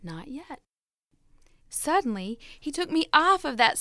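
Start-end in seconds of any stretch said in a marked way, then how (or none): 0.0-0.6 much softer, barely voiced and calm; soft calm reply. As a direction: answer quietly, unmoved. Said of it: "the master only nodded".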